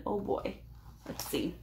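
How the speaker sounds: usual speaking voice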